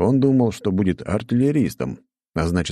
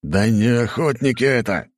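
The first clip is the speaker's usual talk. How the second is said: angry king; 6 semitones higher, 3 dB louder than usual. Acted. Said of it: "Король ударил по столу".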